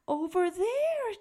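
plain reading voice

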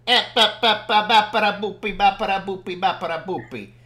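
loud gibberish imitating foreign ranting; punchy babbled scolding, falling away at the end; mock calling out. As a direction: rattle off nonsense syllables like someone telling you off. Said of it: "calling us out, like".

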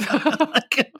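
words broken up by laughter; can barely get it out for giggling; laughing. Real, not acted. Cracking up to his cohost over the scene, laughing.

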